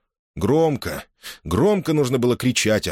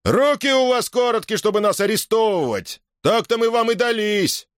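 high, excited crowd cry; defiant shout; 12 semitones higher than usual. A narrator's reading. Agitated soldiers answer the threat of arrest, shouting defiantly. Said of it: "разом закричали".

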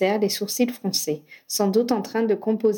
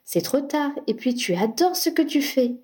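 higher-pitched, mildly whiny protest; moaning; 5 semitones higher than usual. A narrator's reading. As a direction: plead with a light moan. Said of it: "gémit Harry". (protestation un peu gémissante)

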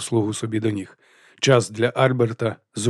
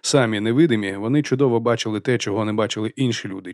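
ordinary delivery, nothing marked

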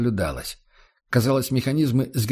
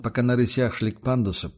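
usual speaking voice

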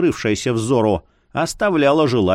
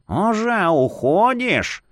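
put-on slow, sinister old man voice; creepy old man; 6 semitones higher, slower than usual. A narrator's reading. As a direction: drawl it like a taunting elder.

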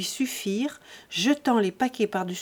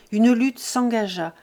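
usual speaking voice

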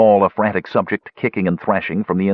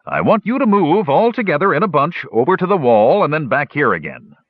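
command; 7 semitones higher, 3 dB louder than usual. A narrator's reading. Flint commanding troops.

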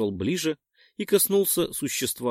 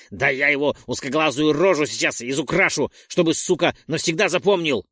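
louder raging threat; furious rant; 3 semitones higher, 5 dB louder than usual. Acted. Roldon rages about beating Perk, furious threat. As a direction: spit out the threat in fury.

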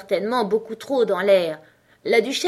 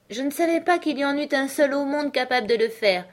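very polite; 7 semitones higher than usual. A narrator's reading. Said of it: "dit Alice très poliment".

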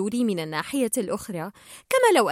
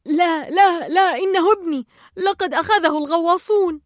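frantic father; 7 semitones higher, 6 dB louder than usual. Acted. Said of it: "سبح مارلين نحو القناع قائلًا".

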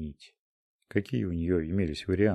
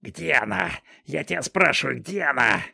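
furious demand, raised voice; angry shouting; 6 semitones higher than usual. Acted. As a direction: shout the question at her. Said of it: "донеслись крики".

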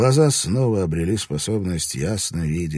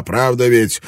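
pouncing demand; 6 semitones higher, 7 dB louder than usual. A narrator's reading. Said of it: "налетел он на Марика".

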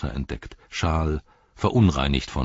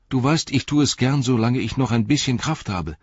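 higher, pleading, full of pity; eager pitying plea; 7 semitones higher than usual. A narrator's reading. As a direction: beg him gently to share the load. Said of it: "vor Mitleid".

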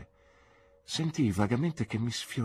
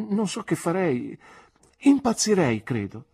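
higher, louder, rising with emotion; passionate outburst; 10 semitones higher, 7 dB louder than usual. Acted. Young man tells his aunt he'd go mad, passionate rise. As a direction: swept away by his feelings.

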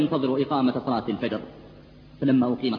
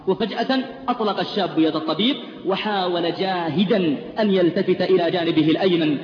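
ordinary talk, nothing marked

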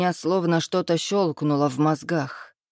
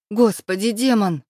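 raised voice, higher and louder; angry cry; 5 semitones higher, 5 dB louder than usual. A narrator's reading. Angry muse cries out at her jealous man, exasperated. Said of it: "закричала муза, гневно".

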